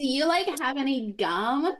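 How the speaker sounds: put-on high, slow Valley Girl drawl. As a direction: ask it like a bored Valley Girl. Joining the Valley Girl bit.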